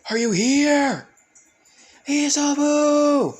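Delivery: high, loud calling out, last name drawn out slowly; yelling a name. Acted. Izuku yelling for Isobu in the golden place, high and loud. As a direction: call out desperately into the empty place. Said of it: "he started yelling Isobu's name".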